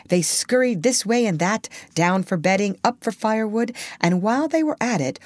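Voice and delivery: plain reading voice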